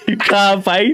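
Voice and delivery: laughing through a slow, high jibe. Real, not acted. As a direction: tease him while cracking up. Laughing retelling a puny kid.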